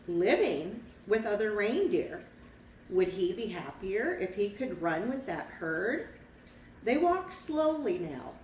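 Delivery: put-on lively read-aloud for a child; picture-book narrator voice; storybook narration. A narrator's reading.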